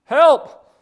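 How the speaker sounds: loud, high-pitched cry for rescue; yelling for help. Acted. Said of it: "Jack yells out again".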